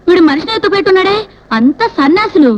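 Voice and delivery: ordinary talk, nothing marked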